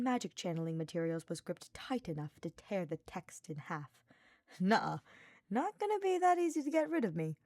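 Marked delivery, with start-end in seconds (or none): 4.6-7.4 smug, high and playful; smirking. Acted. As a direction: grin while you refuse. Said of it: "Sylvain smirked".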